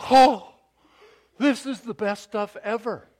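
loud, high gushing delight; gushing over food. Retold or imitated. Customer raving to the food truck owner, loud gushing delight. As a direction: rave about the food like a fan. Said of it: "went in there and went".

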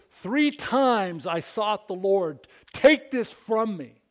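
slow, high, loud appeal to God; forceful plea. Acted. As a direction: plead with strong force, stretching the words. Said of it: "to convey strong force".

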